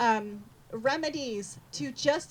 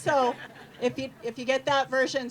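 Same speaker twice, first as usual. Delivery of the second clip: ordinary talk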